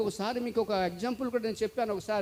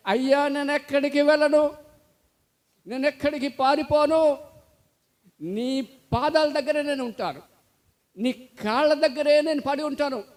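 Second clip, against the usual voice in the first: louder, higher earnest pleading; pleading slave; 4 semitones higher, 7 dB louder than usual. Acted. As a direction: beg at his feet, voice raised and raw. Freed slave falls at master's feet. (యజమాని కాళ్ళ మీద పడి బానిస వేడుకోలు, గట్టిగా)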